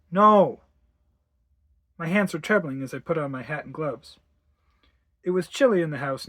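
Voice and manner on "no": loud, abrupt refusal; firm refusal. Acted. Mother refusing to bring Harry, loud and firm. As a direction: cut the child off loudly.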